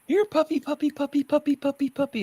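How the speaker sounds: high, monotone sing-song dog call; mock puppy-calling. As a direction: imitate a stranger cooing for a puppy in a very high pitch. Imitating woman calling the dog.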